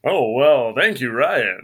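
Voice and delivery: loud, hammed-up swooping thanks; mock gravitas. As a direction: thank him with put-on grandeur. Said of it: "now you got gravitas".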